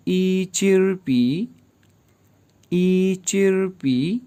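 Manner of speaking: ई चिर पी said very slowly, syllable by syllable; Chinese phrase drill. Shown as a demonstration. Teacher drilling a Chinese phrase, very slow and careful. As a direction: spell out ई चिर पी slowly for the students. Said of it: "तो कहेंगे".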